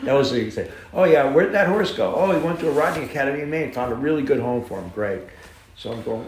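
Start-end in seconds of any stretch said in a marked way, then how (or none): 0.9-4.9 mock casual cover story. Mimics the racetrack's cover story.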